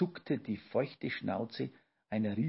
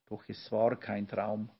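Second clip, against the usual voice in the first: plain reading voice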